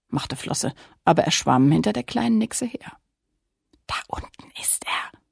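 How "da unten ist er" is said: breathy hushed whisper, voice gone. Acted. Lilli pointing out the king to Flosse, whispered. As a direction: whisper so the giant can't hear. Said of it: "flüsterte Lilli".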